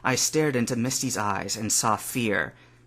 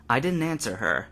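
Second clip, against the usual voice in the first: ordinary delivery, nothing marked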